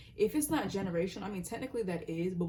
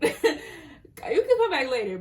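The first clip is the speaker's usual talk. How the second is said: giggling through the words, then louder; laughing; 8 semitones higher, 10 dB louder than usual. Real, not acted. Reader bargaining with a memory, laughing throughout. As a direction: laugh while telling the thought to wait. Laughing at her own intrusive thought.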